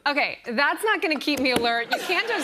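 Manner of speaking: exasperated driver pleading for help; retold exasperation. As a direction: object in mock disbelief, voice climbing. Retold protest to stepfather.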